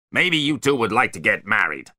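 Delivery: sharp, loud bark. A narrator's reading. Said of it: "he barked".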